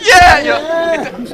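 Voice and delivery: sudden loud high whoop; a big mock crowd cheer. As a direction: cheer like a crowd praising a coward. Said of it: "everyone being like".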